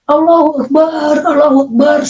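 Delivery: acted-out yell for deliverance; re-enacted cry. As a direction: cry out high, stretching the vowels. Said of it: "Saya berteriak".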